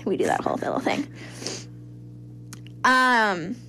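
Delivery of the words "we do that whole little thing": mock whisper. FBI voiced as sly aside.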